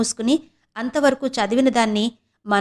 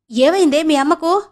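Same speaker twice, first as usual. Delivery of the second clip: sudden worried question, pitched up and louder; alarmed; 6 semitones higher, 5 dB louder than usual. A narrator's reading. Uncle suddenly alarmed about his sister's health, asks anxiously. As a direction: ask in a startled rush of worry. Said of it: "అన్నాడు కంగారుగా".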